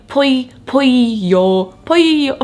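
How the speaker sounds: pollo said slowly, the Spanish ll fumbled with a lisp; struggling to say pollo. Shown as a demonstration. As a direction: sound out pollo carefully through the lisp. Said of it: "I can't say turn".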